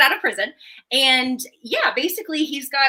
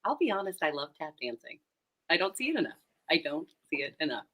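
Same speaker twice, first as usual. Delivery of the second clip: hushed, soft admission; quiet confession; 9 semitones lower, 12 dB quieter, faster than usual. Acted. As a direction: say it softly, almost to yourself.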